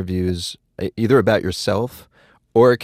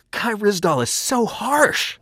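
whiny complaint; 11 semitones higher, slower than usual. Acted. Comic whine after slap.

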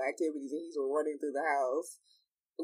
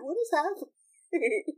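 high, slow, laughing what is happening; mock bewildered cry, much higher; laughing disbelief; 13 semitones higher, 4 dB louder, slower than usual. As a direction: act baffled through laughter. Laughing reaction to his friend.

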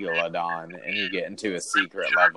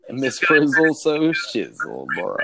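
slow, higher, louder bro voice; frat bro; 7 semitones higher, 4 dB louder, slower than usual. Acted. Rhyming bro joke.

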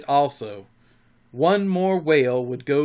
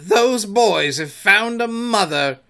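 loud, high, slow declaration; impassioned pirate captain's outcry; passionate cry; 7 semitones higher, 7 dB louder, slower than usual. A narrator's reading. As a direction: proclaim the bad news dramatically. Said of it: "he spoke passionately".

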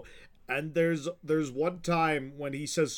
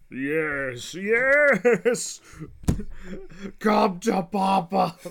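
higher, louder, slow off-voice; Mr. Krabs impression; 6 semitones higher, 8 dB louder, much slower than usual. Fan doing Mr. Krabs gloating over money. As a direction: growl it like a greedy crab. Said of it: "my Mister Krabs impression".

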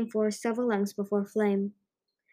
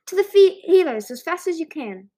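loud, raised-pitch command; urgent order; 6 semitones higher, 9 dB louder than usual. A narrator's reading. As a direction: call out to send helpers hurrying. Urgent order to carry off wounded.

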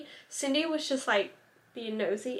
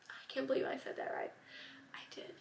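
hushed aside to viewers, whispered; 16 dB quieter, faster than usual. Real, not acted. True crime vlogger to viewers, embarrassed whisper. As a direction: drop to a whisper as if confessing. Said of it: "unvoiced whisper".

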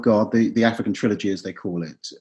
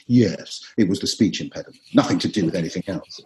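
mock cover-up line; 6 semitones lower than usual. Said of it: "in the play, of course, make a joke of".

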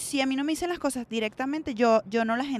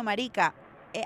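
normal talking voice

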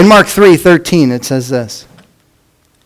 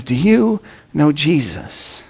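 soft retold question; 8 dB quieter, slower than usual. Retelling the same question softly.

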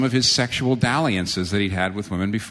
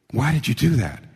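resentful question; 3 semitones higher than usual. Acted. Voicing resentful reaction.

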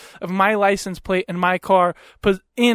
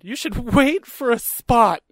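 slow, higher, laughing imitation; mocking her words; 4 semitones higher, slower than usual. Man repeats woman's scolding, amused drawn-out mockery. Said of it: "That's what she said".

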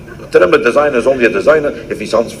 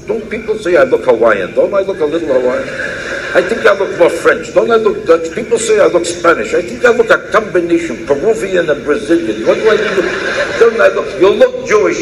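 higher, fishing for compliments; coy and pleading, voice pitched up; 6 semitones higher than usual. Acted.

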